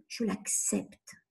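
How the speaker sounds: slow, soft whisper of acceptance. Shown as a demonstration. Coach models accepting the emotion, slow whisper. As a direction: let the words settle, whispered slowly. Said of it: "Que mes oreilles entendent".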